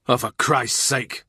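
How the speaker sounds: slow exasperated outburst. Acted. Cromwell exasperated at More.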